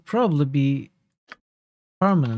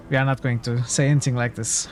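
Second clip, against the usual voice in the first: normal talking voice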